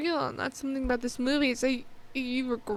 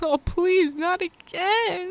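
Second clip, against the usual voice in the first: higher and louder, then slow on "not again"; pleading dismay; 5 semitones higher, 5 dB louder, slower than usual. Retold or imitated. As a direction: beg the screen to spare her. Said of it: "I was like".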